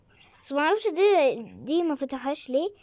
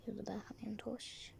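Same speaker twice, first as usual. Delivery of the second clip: whisper; 3 semitones lower, 19 dB quieter than usual. Real, not acted. Sneaking into shop.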